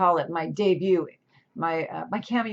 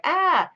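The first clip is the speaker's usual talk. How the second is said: high, strangled "ah" of a frazzled agent; a sharp, high yelp of despair from the agent caught in the middle; exasperated agent cry; 10 semitones higher, 3 dB louder than usual. Retold or imitated. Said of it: "And then agents like".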